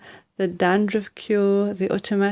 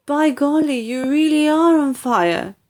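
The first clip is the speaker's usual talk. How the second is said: put-on amazed boy; 6 semitones higher than usual. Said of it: "George said".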